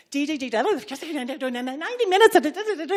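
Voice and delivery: fast high excited jabber; excited babble. Retold or imitated. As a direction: babble breathless news. Excited teacher rushing in.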